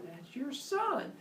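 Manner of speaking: high, slow, soft deadpan; a high, slow deadpan reveal; high, slow Maya. Retold or imitated. Maya voiced in dialogue.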